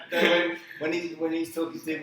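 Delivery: bursts out laughing, high and loud; real laugh breaking into words. Real, not acted. Laughs at kicking joke.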